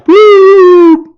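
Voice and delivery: loud high mock siren blip. Police car lights up behind him, loud mock siren blip. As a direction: imitate a police siren chirp.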